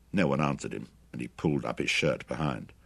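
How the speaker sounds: plain reading voice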